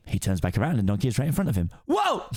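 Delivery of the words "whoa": high startled yelp; startled whoa. Acted. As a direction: jump back in surprise. Shrek startled.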